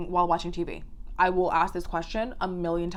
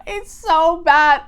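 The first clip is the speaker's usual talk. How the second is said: loud, high words bursting out of laughter; shocked laughter; 8 semitones higher, 9 dB louder, much slower than usual. Real, not acted. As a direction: blurt it out loudly, cracking up from shock. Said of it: "I have to laugh because of the shock".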